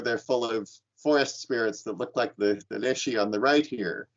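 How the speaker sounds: ordinary delivery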